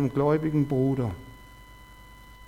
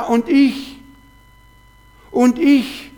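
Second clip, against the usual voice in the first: whiny inner voice; 6 semitones higher, much slower than usual. Retold or imitated. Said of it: "die Stimme ist wieder da".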